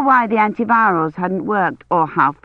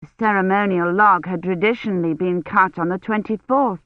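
ordinary delivery, nothing marked

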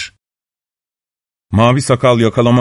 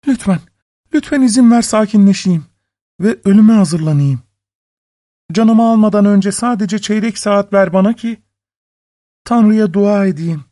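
high, urgent begging; pleading; 8 semitones higher than usual. A narrator's reading. Wife begging for time to pray, high desperate plea. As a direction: beg in a high woman's voice. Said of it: "Hayatını bağışlaması için yalvarmış".

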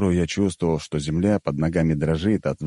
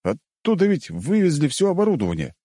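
high-pitched indignant protest; mildly outraged questioning; 10 semitones higher than usual. A narrator's reading. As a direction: protest in disbelief, voice raised high. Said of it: "возмутился я".

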